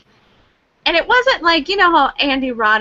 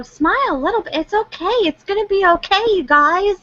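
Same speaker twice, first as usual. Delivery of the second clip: mock reassurance; 9 semitones higher than usual. Coaxing gloomy team.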